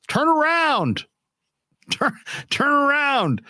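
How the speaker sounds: high-pitched exasperated call; mock calling out. Fan calling to a pitcher facing center field, mock exasperated. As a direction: call it high at a clueless player.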